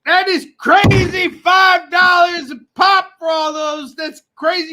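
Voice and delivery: high, loud, slowed hype; hyped amazement. Acted. Host hyping co-host's bundle deal, loud amazed shout. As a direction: sell the bargain with mock amazement.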